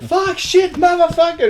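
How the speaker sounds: high, loud acted cursing fit; mock enraged shouting; explosive swearing. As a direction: snap from calm into a yelled tantrum. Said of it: "he just goes real calm for a second".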